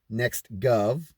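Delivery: usual speaking voice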